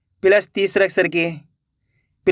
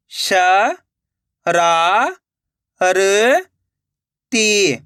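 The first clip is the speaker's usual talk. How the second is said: syllable by syllable; much slower than usual. Shown as a demonstration. Teacher sounding out a word for learners, syllable by syllable. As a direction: separate drawn-out syllables with long gaps. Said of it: "एक एक अक्षर का उच्चारण करके".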